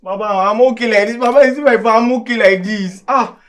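high, loud panicked insistence; frantic pleading. Acted. Collins begs to stay standing.

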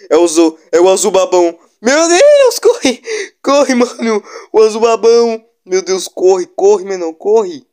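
panicked high yelling; scream-run from the monster; being chased. Real, not acted. Monster appears, panicked fleeing.

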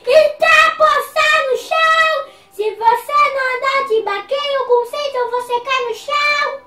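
high, loud sing-song warning. Acted. Boy warns players about falling, high loud sing-song. Child warns in park play.

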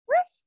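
high yappy ruff ruff like a little puppy; playful puppy barks. Pretend. Teacher barking for kids in downward dog, high yappy. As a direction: yip like a tiny dog. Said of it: "And bark".

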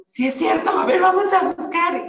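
high, loud sudden realization; exclaiming high and loud; excited realization. Retold or imitated. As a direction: burst out as it dawns on you. Realizing the number matches.

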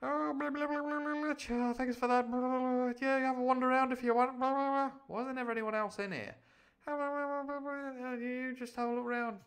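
put-on very high, babbling drunken owl voice; Blathers. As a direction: play a hungover owl fobbing off a visitor, babbling high. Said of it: "I bet Blathers, that's why he's always asleep".

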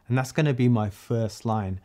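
ordinary delivery

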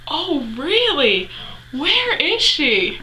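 slow, high, swooping questions; exaggerated drawn-out curiosity; mock flirtatious interest. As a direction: imitate an intrigued flirt, stretching the words. Idol playfully interested in her mom.

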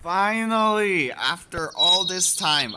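loud, slow, high triumphant finally. Real, not acted. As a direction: savour the long-awaited win. Relief at long wait.

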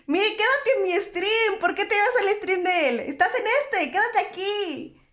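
high-pitched, insistent mock telling-off; playful scolding. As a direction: order them to stay, in pretend indignation. Playfully scolds viewer for leaving stream.

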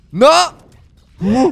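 high, loud cry; real dismayed shout. Real, not acted. Player hit during the boss fight, dismayed shout. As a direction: burst out in sudden dismay.

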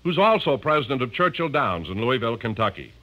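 hearty, even announcer pitch for cigarettes; commercial read. Acted. Radio announcer selling Luckies to listeners, hearty ad read. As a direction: sell the tobacco like a radio ad man.

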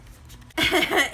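short bright giggle; light laugh. Real, not acted. Laughs after the cooing.

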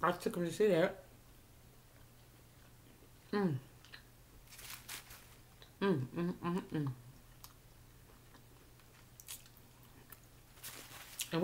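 humming through a mouthful; muffled closed-lip murmurs; chewing with mouth closed. Real, not acted. Seen 0: Closed moving lips while peeling shrimp; bite taken earlier at -9.

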